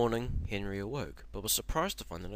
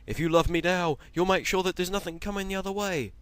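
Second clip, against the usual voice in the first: much higher, louder pleading shout; desperate shout; 9 semitones higher, 7 dB louder than usual. Acted. Henry pleading with God to keep the line clear, shouting. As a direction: beg heaven at the top of your voice. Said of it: "Henry shouted".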